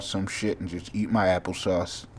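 ordinary talk

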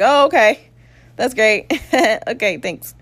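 high, forced cheerful okay; sarcastic. Retold or imitated. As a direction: fake cheer at scary news. Sarcastic reply about stroke.

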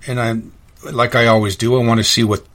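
ordinary talk

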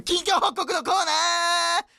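high, loud, drawn-out announcement; shouted title call. Pretend. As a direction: yell the title with full force. (コーナー名を高く大声で絶叫)

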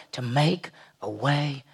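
slow, hushed whispered close. Real, not acted. Preacher whispers the promise to the congregation, slow and hushed. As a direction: breathe the promise out slowly.

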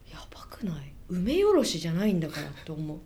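hushed unvoiced aside; whispering. Real, not acted. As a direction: whisper it like a secret.

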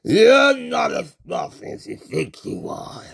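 a hint of slow menacing monster taunt; lightly voiced monster, taunting slowly; menacing Cthulhu. Cthulhu taunting the fooled human, lightly voiced slow menace.

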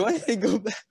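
high, mocking order; imitated instructor taunt. Instructors sending eager recruit back to the mud, high mock order.

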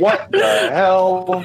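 distorted underwater-sounding voice; warbled bubbly connection glitch. Real, not acted. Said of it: "You sound like you're underwater".

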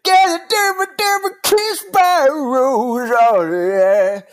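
high, loud hammy villain; hammy movie villain. Retold or imitated. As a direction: ham up a campy villain.

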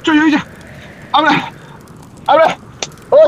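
high, loud alarmed yelling; acted panic, shouting at a friend who sees things; panicked shout. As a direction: snap him out of the hallucination. Partner hallucinating, he shouts alarm.

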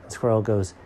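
plain reading voice

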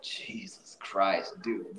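slow, breathless whisper; awed whisper. Real, not acted. Marveling at how hard Sherwin works, whispered. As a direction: breathe it out in awe. Awed by a friend's work ethic.

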